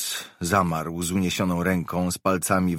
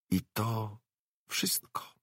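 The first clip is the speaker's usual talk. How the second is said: much softer, fading into a whisper; hushed; 4 semitones higher, 9 dB quieter than usual. A narrator's reading. Narrator closes the box description, dropping to a whisper. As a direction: let it die away to a breath.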